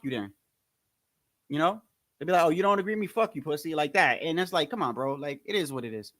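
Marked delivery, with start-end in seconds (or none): none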